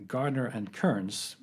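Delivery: normal talking voice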